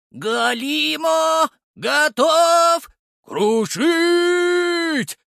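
high, slow, loud golem chant; golem shout. Acted. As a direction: boom it out in several voices. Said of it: "разными голосами сказал голем".

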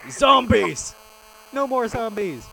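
high, loud exaggerated cheer; mock cheer. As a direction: celebrate like a silly fan.

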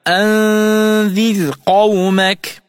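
slow chanted recitation of أنذر قومك; Quranic recitation. Shown as a demonstration. Teacher reciting the verse as an example. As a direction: intone the verse in tajweed style. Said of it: "قوله تعالى".

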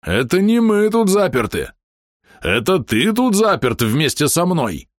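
high, slow menacing threat; menacing shout. A narrator's reading. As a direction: bellow it as a warning.

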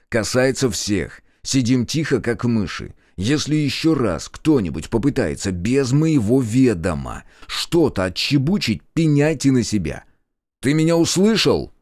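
slow stern order, rising in pitch and force; stern warning. A narrator's reading. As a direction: lay down the law, building to a demand. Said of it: "строгим голосом произнёс я". (строгое предупреждение, с нарастающим нажимом)

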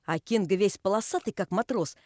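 ordinary delivery, nothing marked

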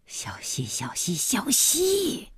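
hushed calls climbing to a high cry; anxious husband trying to stop the wife; alarmed pleading. A narrator's reading. Husband urgently pleading, restraining wife.

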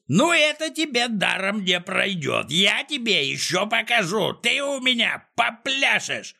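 high-pitched furious threats; Клопс yelling, choked with anger. A narrator's reading. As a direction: rant in a high, enraged voice, threatening revenge. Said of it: "заорал, задыхаясь от гнева".

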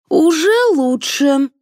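high, drawn-out shout. A narrator's reading. Director shouts to the actress during filming, shouting. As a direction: call it out slowly, high-pitched. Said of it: "крикнул Григорий".

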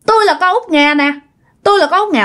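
put-on a dead girl's ghost speaking through the woman, high and insistent; possessed woman's voice. Possessed woman tells the mother who she is, in a high ghost voice. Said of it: "Mới nói rằng hả".

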